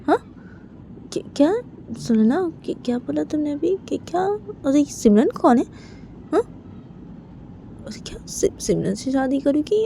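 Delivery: halting, stammered disbelief with rising questions; stunned father. Acted. Father hearing his daughter will marry Simran, shocked and halting. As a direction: break the questions up with stunned pauses.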